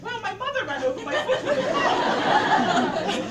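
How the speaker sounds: high, loud, slow laughing line; laughing retelling. Retold or imitated. Girl telling the doctor about her mother, laughing hard. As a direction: say it while cracking up. Retold answer through laughter.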